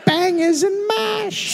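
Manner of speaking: very high slow British yip; mock Cockney dog. Pretending the dog barks English food. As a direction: stretch it out like a squeaky dog.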